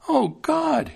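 high, sudden cry; Thumbling waking in a cow's jaws; startled exclamation. A narrator's reading. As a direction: jolt out a cry of shock. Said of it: "he exclaimed".

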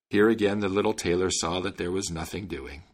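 ordinary delivery

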